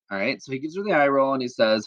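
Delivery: usual speaking voice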